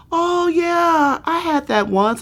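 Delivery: high, breezy imitation of relatives; casual relatives. Relatives shrugging off fibroids, a high breezy mock voice. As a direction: toss it off like a chatty aunt.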